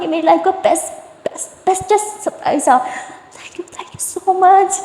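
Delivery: high, slow, gushing thank-you; overjoyed thanks. A narrator's reading. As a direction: gush with delight at the surprise. Overjoyed thanks after proposal. (خوشی سے چہکتی ہوئی، اونچی آواز)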